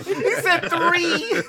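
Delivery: high, slow laughing repeat. Real, not acted. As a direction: say it through laughter.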